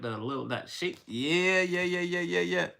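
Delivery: ordinary talk, nothing marked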